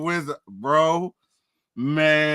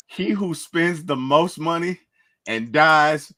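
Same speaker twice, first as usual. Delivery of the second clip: slow quote; much slower than usual. Retold or imitated. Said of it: "He said".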